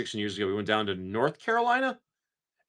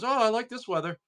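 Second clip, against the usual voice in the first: high mock-cheerful weather lover; lightly voiced chipper guy who loves cold; 12 semitones higher than usual. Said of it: "steps outside and goes".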